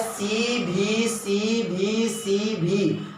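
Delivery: drawn-out letter-by-letter count; syllable-counting demo. Shown as a demonstration. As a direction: chant the letters evenly, stretching each one. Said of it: "ऐसे करके". (अक्षर खींच-खींचकर गिनते हुए)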